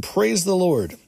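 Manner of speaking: a high, joyful exclamation of praise; exclaimed praise. A narrator's reading. Church brother to a healed man, exclaiming high. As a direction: lift it high like a sudden cry of joy.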